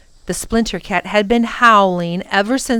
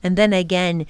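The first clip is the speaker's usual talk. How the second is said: plain reading voice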